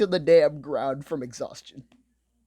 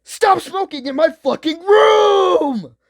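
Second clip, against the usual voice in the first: screaming mock roommate, high and much louder; furious yell; 12 semitones higher, 9 dB louder than usual. Roommate screaming at the smoker to stop, loud furious shout. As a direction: yell it like someone fed up with smoke in her room. Roommate yelling about smoking.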